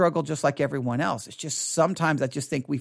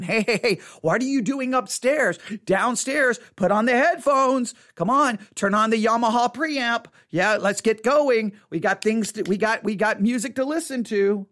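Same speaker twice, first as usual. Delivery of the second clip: high, coaxing voice of temptation; tempting inner voice; 6 semitones higher, 3 dB louder than usual. Retold or imitated. Temptation calling the pastor to his music, high and coaxing. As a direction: coax him toward the music.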